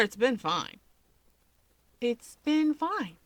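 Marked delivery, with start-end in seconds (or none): 2.0-3.2 flat disbelief. Acted. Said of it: "Keigo repeats".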